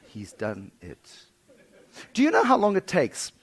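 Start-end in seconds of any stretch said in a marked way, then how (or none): 0.1-1.2 dread realization. Retold or imitated.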